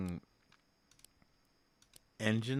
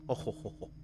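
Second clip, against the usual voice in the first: mock posh chuckle; much faster than usual. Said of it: "it's in an accent".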